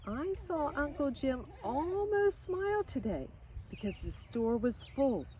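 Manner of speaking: put-on lively storybook reading of a girl's letters; reading to children. A narrator's reading. Reading Lydia Grace's letters home to listening kids, storybook voice. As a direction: read it like a bedtime story, bright and gentle.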